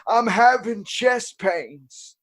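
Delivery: put-on high, loud, drawn-out commercial old person. Mocking old-person commercials for a laugh, hammed-up elderly voice. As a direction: wail it slowly like an elderly ad actor. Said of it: "do the old person commercials".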